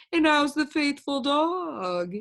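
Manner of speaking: slow, smooth friendly stranger; oily polite gentleman voice; amiable gentleman. Acted. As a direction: greet him sweetly, slowly.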